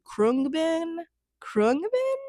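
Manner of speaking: "Krungbin" tried high and questioning; high, quiet, doubtful stab at "Krungbin"; unsure pronunciation. Real, not acted. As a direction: guess at "Krungbin", pitch climbing on the repeat. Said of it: "I don't know".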